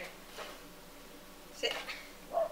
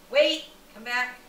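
ordinary talk, nothing marked

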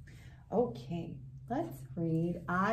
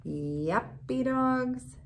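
slow, sing-song picture-book reading; gentle rhyming read-aloud; reading to children; slower than usual. A narrator's reading. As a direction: savour each rhyme slowly for little ones. Reads rhyming dog book aloud.

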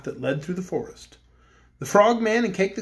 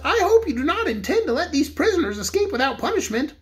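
higher-pitched officious bear voice; a fussy toy soldier-bear, pitched up; Corporal Waddle; 10 semitones higher than usual. A narrator's reading. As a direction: corporal demanding punishment, higher voice.

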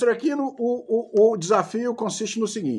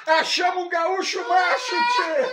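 laughing shout; 11 semitones higher, slower than usual. Real, not acted. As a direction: shout it through a burst of laughter. Cracking up at friend's toughness.

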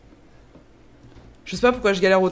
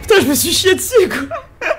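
high laughing, half crying with fright; scared laughter; 8 semitones higher, 8 dB louder than usual. Real, not acted. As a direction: laugh through the shock, very high.

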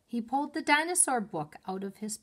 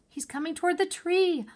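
much higher, loud alarmed exclamation; frightened cry; 10 semitones higher, 6 dB louder than usual. A narrator's reading. Annie warns Jack about the charging dinosaur, alarmed cry. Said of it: "Annie cried".

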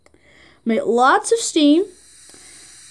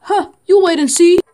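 higher and louder, sure of himself; confident driver; 6 semitones higher, 6 dB louder than usual. Acted. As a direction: promise Gordon he's wrong. Driver answers Gordon.

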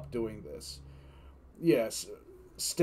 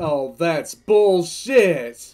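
loud, slow outraged cry; 3 semitones higher, 14 dB louder, much slower than usual. Real, not acted. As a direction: drag it out in outrage. Streamer outraged at payout rule.

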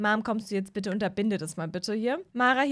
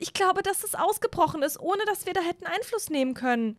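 higher anxious mom about the wolf gene; worried mom; 7 semitones higher than usual. Acted. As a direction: confide your fear to your husband. Mom Mara worried about werewolf son.

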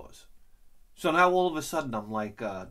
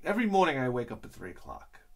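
normal talking voice

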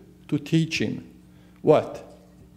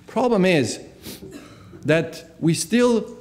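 usual speaking voice

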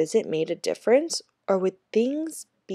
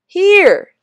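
much higher, louder shout; 12 semitones higher, 12 dB louder than usual. A narrator's reading. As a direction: bark it as you throw the ball. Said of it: "Tyrone shouted".

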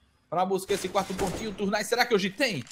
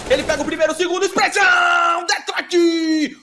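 shouted kill call; 8 semitones higher, 8 dB louder than usual. Real, not acted. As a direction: explode and hold the key word. Grito de narração no abate. (grito esticado de narrador)

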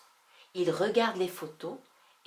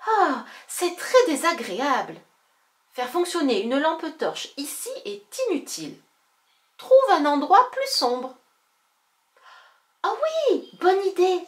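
raised, bright character voice calling out; excited girl character; 8 semitones higher, 8 dB louder than usual. Acted. Girl startled by a flashlight, loud and lively.

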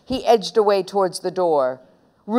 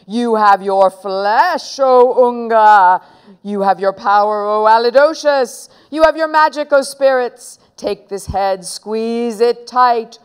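incantation; 3 semitones higher, 5 dB louder than usual. Acted. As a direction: call on the spirits with stretched words. Boy carries on his mock curse.

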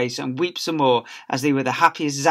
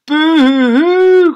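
high, slow, loud mock wail; baby boo-hoo crying; 14 dB louder, much slower than usual. A narrator's reading. Baby wailing at birth, loud hammed-up boo-hoo. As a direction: bawl it out like a huge baby. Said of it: "cried his adoring parents".

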